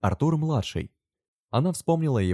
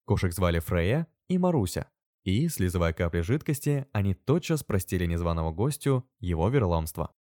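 plain reading voice

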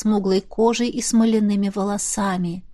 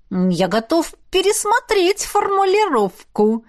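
slow, higher, sly and poisonous; venomous smile; 8 semitones higher than usual. A narrator's reading. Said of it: "ядовитая улыбочка".

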